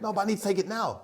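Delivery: high, whiny plea for the keys now; pleading buyer. Retold or imitated. Car buyer begging the dealer for the keys, high whiny plea. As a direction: beg like an impatient customer.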